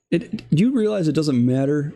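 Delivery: usual speaking voice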